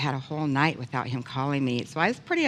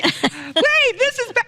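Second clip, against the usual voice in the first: laughing protest; 5 dB louder, slower than usual. Retold or imitated. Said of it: "I'm like".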